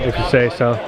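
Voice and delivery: ordinary talk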